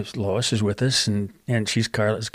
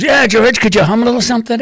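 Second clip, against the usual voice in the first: higher, louder, fast teasing copy of a famous singer; 9 semitones higher, 7 dB louder than usual. Retold or imitated. [name] teasing [name] by quickly echoing the question higher and louder. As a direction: rattle the question back quickly in a raised mocking voice. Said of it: "Like [name], right?".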